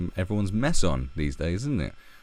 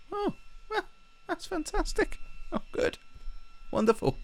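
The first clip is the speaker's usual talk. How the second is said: sarcastic delight; 6 dB quieter than usual. Retold or imitated.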